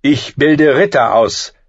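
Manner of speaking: loud, slow, sharp retort. A narrator's reading. Master-at-arms rebuffing the knight's insult, sharp. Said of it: "sagte Ser Roderick scharf".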